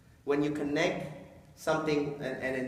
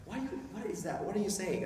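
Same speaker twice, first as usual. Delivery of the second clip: coworker's confused voice; 5 semitones higher, 4 dB quieter than usual. Retold or imitated. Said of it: "It's like".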